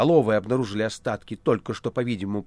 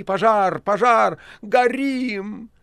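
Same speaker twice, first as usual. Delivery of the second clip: high, loud panicked cries; desperate shouting; 9 semitones higher, 6 dB louder, much slower than usual. Acted. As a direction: yell for help at a fire. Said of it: "отчаянные человеческие крики".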